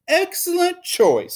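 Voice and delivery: high, slow approving praise; pleased teacher. Acted. Teacher approves the class's answer, slow high praise. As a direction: praise it warmly like a proud teacher. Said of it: "said Mister Slinger".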